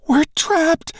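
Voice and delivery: very high, loud panicked squeal. Acted. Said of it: "Bashira squeals".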